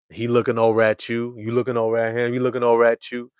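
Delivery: usual speaking voice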